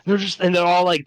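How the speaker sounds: ordinary delivery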